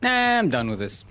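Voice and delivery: high, loud fed-up outburst; acting out a student giving up; fed-up quitting. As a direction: throw up the homework in protest. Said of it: "And Yaku's like".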